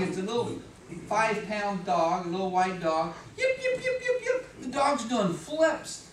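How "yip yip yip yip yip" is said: very high, squeaky excited yips; imitated dog yipping. Little dog greeting the daughter, excited yipping. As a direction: yap like a tiny overjoyed dog.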